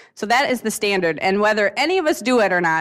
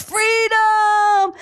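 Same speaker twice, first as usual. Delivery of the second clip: huge high yell, held long; Braveheart battle cry; 12 semitones higher, 4 dB louder than usual. Retold or imitated. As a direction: roar it like a warrior dying on the field.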